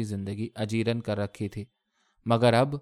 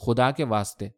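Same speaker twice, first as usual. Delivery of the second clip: ordinary delivery, nothing marked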